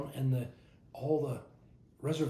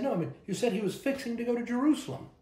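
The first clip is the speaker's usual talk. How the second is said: retold insistence, higher and quick on "fixing"; insistent correction; 9 semitones higher, faster than usual. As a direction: push "fixing" as the word in question, pitched up. Friends retold insisting on his odd word.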